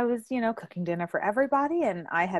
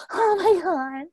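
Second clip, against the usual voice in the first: higher startled exclamation; mock shocked nephew; 9 semitones higher, 4 dB louder, slower than usual. Nephew after being slapped, mock shocked. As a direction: play his stunned gasp. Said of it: "he was all".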